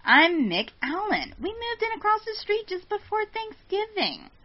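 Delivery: high put-on voice, huffy; getting an attitude. Intruder explaining he moved in across the street, high-pitched attitude. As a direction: stretch the name, then climb into exasperated high pitch. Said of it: "he's getting an attitude now".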